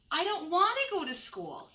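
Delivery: put-on high, whiny little boy voice on the edge of tears; a small child begging not to go to school; crying little raccoon. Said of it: "and cried".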